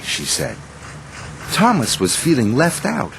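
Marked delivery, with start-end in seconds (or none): none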